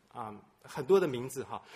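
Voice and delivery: ordinary talk, nothing marked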